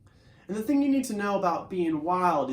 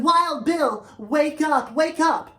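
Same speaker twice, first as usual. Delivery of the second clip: much higher, louder horse voice calling his friend awake; eager horse calling; 10 semitones higher, 8 dB louder, slower than usual. Acted. Tempo the horse shouts to wake sleeping Wild Bill, high eager call. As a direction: bounce in, shouting him awake. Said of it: "you can know that Tempo is talking".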